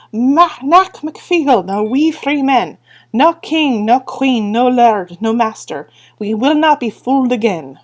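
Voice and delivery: a high, loud war chant; battle cry. Acted. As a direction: swords in the air, they roar their creed. Feegles' battle cry, swords raised.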